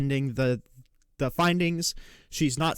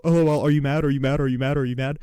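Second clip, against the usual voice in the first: mocking taunt; 4 dB louder, faster than usual. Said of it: "you just have to go".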